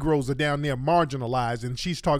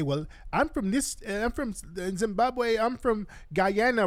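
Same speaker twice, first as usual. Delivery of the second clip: higher, softer mimicking voice; mocking imitation; 6 semitones higher, 4 dB quieter, slower than usual. Host mimicking immigrants naming their homelands, higher mocking imitation. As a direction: imitate them, drawn out and high. Mimicking foreigners stating origins.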